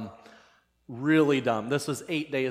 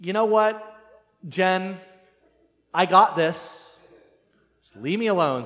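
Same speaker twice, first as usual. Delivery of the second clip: loud, higher, in separated bursts; defiant retort; 5 semitones higher, 5 dB louder than usual. Retold or imitated. Husband dismissing his wife's warning, acted defiance. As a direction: shut down the argument with mock bravado.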